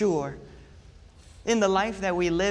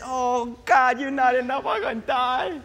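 hammed-up whine to God, raised; mock panicked prayer; 5 semitones higher, 3 dB louder than usual. Believer panicking when turbulence hits, mock fear. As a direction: cry out that it's not enough. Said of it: "when the turbulence hits".

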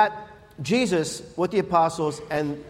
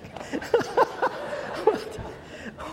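airy laugh; 4 dB quieter than usual. Real, not acted. Laughing at own joke.

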